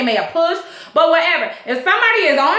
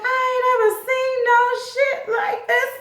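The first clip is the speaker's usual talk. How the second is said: higher, sing-song imitated disbelief; amazed crew member; 8 semitones higher, 4 dB quieter than usual. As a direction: voice the stunned boat crew. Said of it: "crew standing around like".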